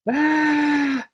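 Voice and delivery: high, drawn-out mock scream; imitated movie scream. Horror fan imitating the film's ending, mock scream.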